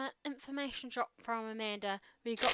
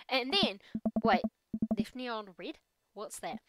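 usual speaking voice

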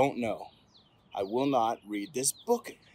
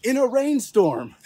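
high, loud burst of drama; escalating story excitement; dramatic add-on; 8 semitones higher, 5 dB louder than usual. A narrator's reading. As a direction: hit the new danger with big energy. Escalating cumulative refusal.

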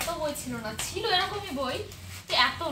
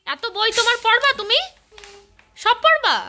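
high, loud teasing question; playful teasing; 6 semitones higher, 9 dB louder than usual. Real, not acted. Teasing the child about books. (মজা করে খোঁচা দেওয়া)

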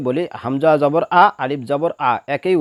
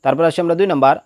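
usual speaking voice

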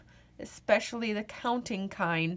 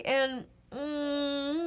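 stifled yawn hum; 4 semitones higher than usual. Acted. Yawn sound mid-story.

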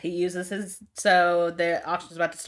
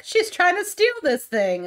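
much higher, louder fake panic; fake alarm; 12 semitones higher, 6 dB louder than usual. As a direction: tattle to the guards in mock alarm. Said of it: "he's like".

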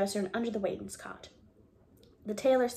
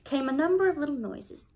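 plain reading voice

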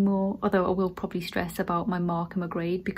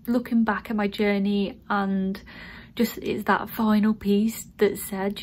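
normal talking voice

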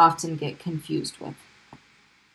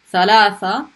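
ordinary talk

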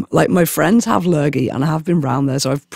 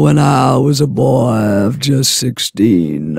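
raspy cold voice; 5 semitones lower, slower than usual. Real, not acted. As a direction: croak it out like a chain smoker. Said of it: "I literally sound like a fucking seven hundred-year-old chain smoker".

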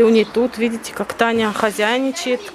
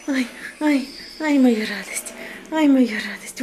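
cooing to pet; 2 semitones higher than usual. Real, not acted. Cooing to her cat.

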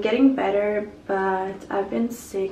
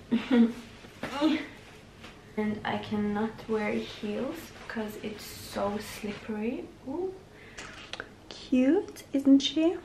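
ordinary talk, nothing marked

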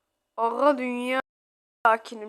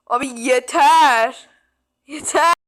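fed-up outburst; 2 semitones higher, 6 dB louder than usual. Real, not acted. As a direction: shout enough is enough. Fed up with game. (bıkkın bir çıkış)

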